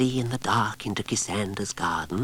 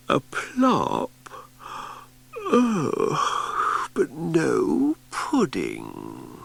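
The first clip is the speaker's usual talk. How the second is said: sleepy puzzled wail; 8 semitones higher, much slower than usual. Acted. Repeated in her sleep.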